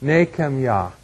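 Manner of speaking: Nehemiah as nay-khem-YAH; three-syllable pronunciation. Shown as a demonstration. Teacher demonstrating a three-syllable word, Nehemiah pronounced nay-khem-yah. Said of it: "a three-syllable word".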